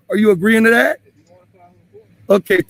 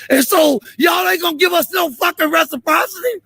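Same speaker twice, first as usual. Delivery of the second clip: furious raised voice; 7 semitones higher than usual. Real, not acted. To the officer about the neighbors blocking his street, furious high shout. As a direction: pitch jumps high as he demands reciprocity. Angry confrontation with police officer.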